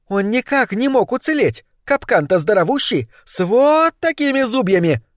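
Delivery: high-pitched emphatic exclamation; exclaiming. A narrator's reading. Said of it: "воскликнула злокознь". (восклицая высоким голосом)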